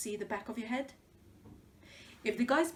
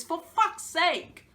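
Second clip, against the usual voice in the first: exasperated; 6 semitones higher, 6 dB louder, slower than usual. Acted. Exasperated at rivals' braces.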